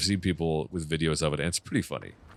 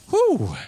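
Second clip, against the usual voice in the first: relieved whew; 6 dB louder than usual. Real, not acted. Relief after beating tough enemy.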